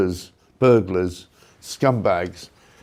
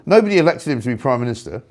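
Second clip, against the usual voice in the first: normal talking voice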